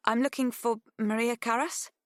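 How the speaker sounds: tentative on the phone; nervous young woman. Acted. Pip on the phone.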